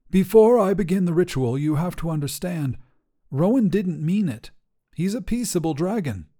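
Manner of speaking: a wizard talking his way out of trouble; wizard Meriden. Acted. Wizard pleads with the Kingsguard, high and earnest.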